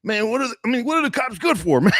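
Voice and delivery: high, incredulous complaint; homeless guy complaining. Retold or imitated. Homeless man complaining about cops, high-pitched. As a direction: whine it up high. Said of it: "a homeless guy go".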